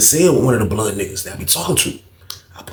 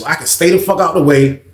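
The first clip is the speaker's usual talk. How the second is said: ordinary talk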